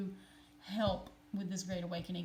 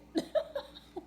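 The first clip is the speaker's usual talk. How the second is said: high giggle; 13 semitones higher than usual. Real, not acted. Laughing at the glitch.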